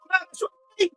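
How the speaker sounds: high, tearful panic; frantic, near-sobbing urgency; panicked. Acted. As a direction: cry out while fleeing for your life. Terrified friend flees from monster.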